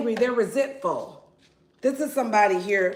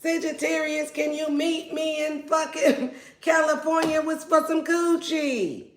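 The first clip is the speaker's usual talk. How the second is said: pleading ex lover; 6 semitones higher than usual. Pretend. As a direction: beg the Sag to meet up. Voicing the yearning ex.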